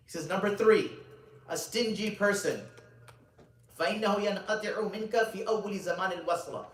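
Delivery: high, loud, emphatic sermon delivery; preaching at full force. Acted. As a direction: preach it out, leaning on key words.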